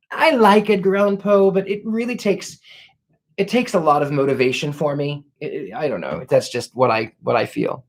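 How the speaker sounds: ordinary delivery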